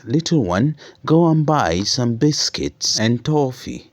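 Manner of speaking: big brother sending his sister for sweets, cheerful. Acted. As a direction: treat her kindly, a touch excited. Brother giving sister money.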